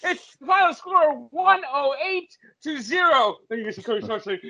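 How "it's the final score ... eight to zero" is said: hammed-up triumphant announcing, stretched out; mock score call. Imagined call of absurd final score.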